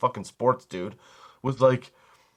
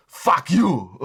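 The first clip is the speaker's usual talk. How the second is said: raised, hammed-up Austrian-accented insult; Schwarzenegger; 4 semitones higher, 4 dB louder than usual. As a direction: throw out a mock action-hero curse, about to laugh. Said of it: "I'm the Arnold".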